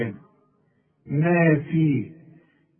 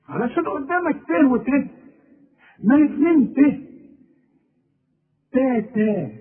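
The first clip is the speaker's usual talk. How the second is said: high, sing-song تا تا; high sing-song baby syllables; childlike syllables; 8 semitones higher than usual. Shown as a demonstration. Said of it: "الأم بتعلم ابنها".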